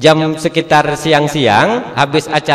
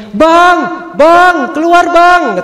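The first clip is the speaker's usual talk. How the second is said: wife screaming; 13 semitones higher, much slower than usual. Retold or imitated. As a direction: shriek for your husband to come out of the bathroom. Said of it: "menjerit biniknya di kamar mandi".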